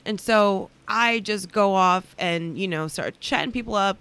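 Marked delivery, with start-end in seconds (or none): none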